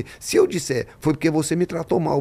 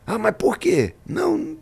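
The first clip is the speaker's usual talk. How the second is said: imitating the puzzled person; slower than usual. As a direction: play the offended person, high and insistent. Pastor imita a outra pessoa. (voz aguda de pessoa intrigada)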